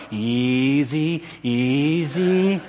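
soft, drawn-out calming voice; hushed, soothing imitation; soothing wife. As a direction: gently talk someone down, imitating a patient wife. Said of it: "usually my wife's going".

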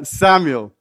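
normal talking voice

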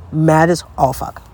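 ordinary talk

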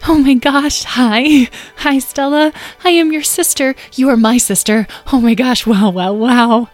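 high, loud squeal of delight; overexcited gushing. A narrator's reading. Excited girl greeting her new-found sister, gushing. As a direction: gush in a high, giddy rush of wonder. Sister meets long-lost sister.

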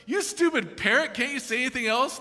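acted exasperation; fed-up burglar. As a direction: scold the parrot, patience used up. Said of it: "finally he's had enough".

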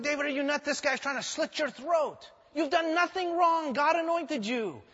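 David's men protesting his mercy. Acted. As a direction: argue that he's crazy to spare Saul.

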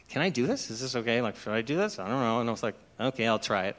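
soft, unsure self-talk; doubting under your breath; quiet dithering to yourself; hesitant inner thought. Retold or imitated. Inner hesitation over the drink.

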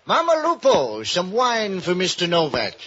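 high, loud call across the room. Acted. Jocko calling to Mama Lupo across the bar for wine, loud call. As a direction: summon the landlady for wine.

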